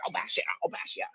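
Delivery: mock threat, all breath and no voice; whispered threat. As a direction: imitate an aggressor threatening in a whisper. Retold threat acted under the breath.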